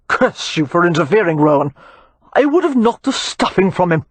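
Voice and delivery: a furious brawler cursing the peacemaker; growled. Acted. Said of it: "he growled".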